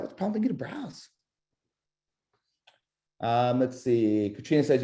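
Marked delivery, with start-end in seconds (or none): 0.0-1.1 high, soft, curious ask; curious question. Shown as a demonstration. Agent probing a buyer, high curious question. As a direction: lift it into a gentle question. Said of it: "And then what's that?".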